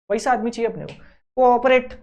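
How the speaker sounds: usual speaking voice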